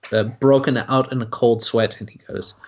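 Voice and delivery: ordinary delivery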